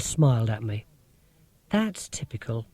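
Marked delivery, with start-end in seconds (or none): 1.7-2.7 soft, dry murmur. A narrator's reading. Marcia murmurs, unimpressed by Rob's dodge, softly. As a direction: murmur it under your breath. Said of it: "she murmured".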